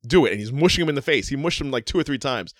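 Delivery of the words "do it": mock yelled command, raised in pitch; imitated yelling. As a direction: retell it as a yelled order. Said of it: "yelling at him".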